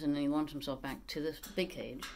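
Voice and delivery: normal talking voice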